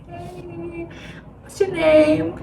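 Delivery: high, sugary imitation of a recruiter; mock friendly recruiter. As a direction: lure them in with a high, drawn-out greeting. Cult recruiter approaches a walker.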